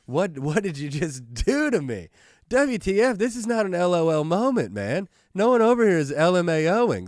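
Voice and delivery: acted protest building to high, loud indignation; mock outrage. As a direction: start aggrieved, build to a hammed-up yell. Acts out his outraged text reply.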